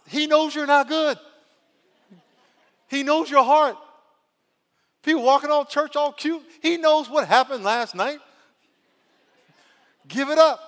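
high, loud preacher's cry; preacher crying out to the church at full force; preaching at full force. Acted. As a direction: shout it from the pulpit.

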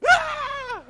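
very high, loud shriek of fright; startled scream. Retold or imitated. Coworkers seeing an open Bible, loud high shriek. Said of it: "People be like".

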